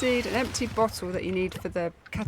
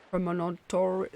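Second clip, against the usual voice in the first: ordinary talk, nothing marked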